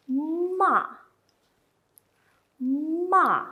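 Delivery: ma falling sharply from high to low; fourth tone. Shown as a demonstration. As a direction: drop ma hard from the top. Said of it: "fall down from somewhere very high to low".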